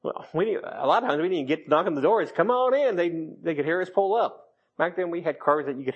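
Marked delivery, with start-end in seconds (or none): 2.4-3.0 Southern welcome. Retold or imitated. Friend Judy welcoming guests, in a Southern accent. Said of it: "That Southern accent just".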